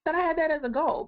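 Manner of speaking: high, wide-eyed wow of discovery; suddenly amazed at her own list; surprised realization. Retold or imitated. Coach retelling her own surprise at a forgotten goal, high and amazed. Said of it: "And I'll go".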